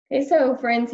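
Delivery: ordinary delivery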